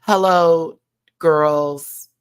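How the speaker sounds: a light take on a glam host saying hello; lightly voiced host greeting; Norvina impression. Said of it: "As Norvina got up there".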